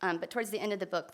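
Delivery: usual speaking voice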